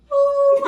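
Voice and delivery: high, loud crying wail; wailing ooh. Retold or imitated. Retells breaking down crying.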